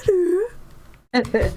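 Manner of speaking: high sing-song hello; dog-greeting voice. Retold or imitated. Mimicking how you'd greet a cat, high and sing-song. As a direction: coo it up high to a pet. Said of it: "I'm talking to a dog voice".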